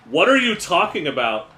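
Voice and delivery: high, loud protest; indignant alligator. Acted. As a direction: protest loudly on a jump in pitch. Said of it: "And by "What are," I mean".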